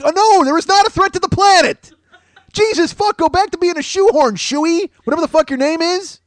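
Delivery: exasperated yell at a shoehorn. Pretend. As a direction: yell at it to go back to being a shoehorn. Scolding shoehorn robot.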